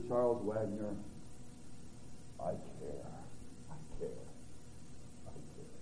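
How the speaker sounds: fading to a soft breath; tender reassurance. Acted. God comforting the preacher, fading soft and low.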